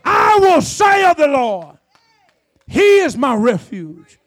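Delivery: loud, slow, ringing declaration; full-force preaching. Real, not acted. Preacher declaring scripture to the congregation, full-force preaching. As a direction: proclaim the verse loudly, stretching the words.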